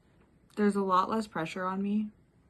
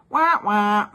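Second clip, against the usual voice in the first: loud hammed-up wah wah whine; fake-cry at your own silliness; mock crying; 11 dB louder than usual. Mocks herself for missing cat.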